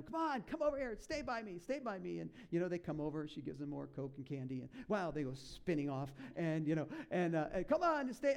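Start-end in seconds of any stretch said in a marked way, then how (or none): 0.0-2.2 high, mock call to the kids; mother calling her kids to stay close, mock yell; imitating a mother yelling. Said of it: "she's yelling at them". 7.7-8.3 high, louder mock call; imitating a mother yelling. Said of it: "she's yelling at them".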